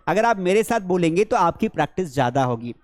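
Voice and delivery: ordinary talk, nothing marked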